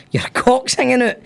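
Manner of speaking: mock fan shout. Guy heckling the penalty taker, mild mock shout. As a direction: imitate a heckler calling out, pitch lifted. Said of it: "a guy shouted".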